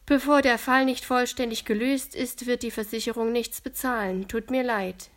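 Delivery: leicht ernster Ton, lauter; sachlich-ernst verkünden. A narrator's reading. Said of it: "sagt dann ernst in die Runde". (Daniel erklärt den Kindern, ein Hauch ernst)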